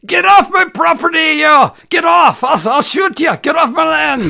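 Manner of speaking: shrieking high Irish farmer yell; angry Irish farmer. Acted. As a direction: scream at trespassers in Irish brogue. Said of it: "What's the Irish welcome?".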